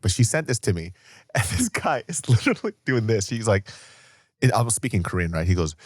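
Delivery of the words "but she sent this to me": usual speaking voice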